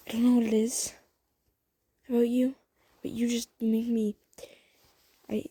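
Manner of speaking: halting, frustrated outpouring; flustered confession. Acted. Crush confesses to listener, flustered and frustrated.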